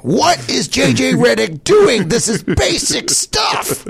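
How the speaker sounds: high, slow ranting yell; yelling YouTuber. Retold or imitated. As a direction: rant like an outraged analyst. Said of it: "he just screams".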